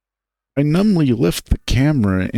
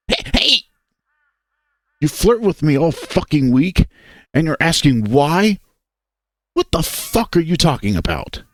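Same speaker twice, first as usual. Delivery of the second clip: angry; 5 semitones higher than usual. Acted. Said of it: "getting angrier".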